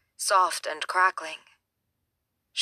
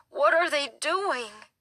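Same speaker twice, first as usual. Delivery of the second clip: put-on high, shaken boy's voice; a scared kid's voice, wavering up high; 8 semitones higher, slower than usual. Boy watching the body bags, high and shaken. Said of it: "in a high, shaken voice".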